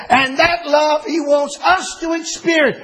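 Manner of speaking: high, slow, shouted preaching; full-force preaching. Acted. Pastor urging his congregation to experience God's love, shouting slowly. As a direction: lift it up and drive it home.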